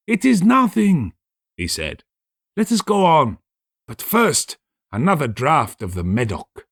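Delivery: the tipsy guest pushing onward; eager Fortunato. Acted.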